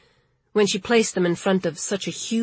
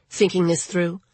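ordinary delivery, nothing marked

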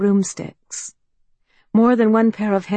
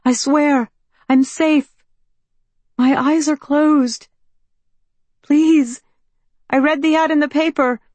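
put-on higher, louder, pleading young woman; anxious young woman; 9 semitones higher, 6 dB louder than usual. A narrator's reading. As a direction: call out, nervous and hopeful. Mallory begging to enter.

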